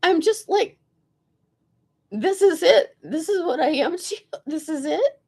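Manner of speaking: high, offended, laughing protest; indignant rant. Retold or imitated. She scolds him on the bus, high and indignant. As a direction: vent it, insulted and incredulous. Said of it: "I was like".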